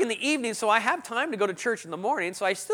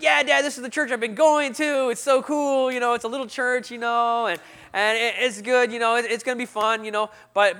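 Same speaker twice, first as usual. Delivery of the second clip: overeager bluff; 4 semitones higher, 6 dB louder than usual. Retold or imitated. Bluffing to dad about church.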